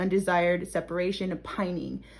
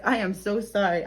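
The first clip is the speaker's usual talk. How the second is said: ordinary talk, nothing marked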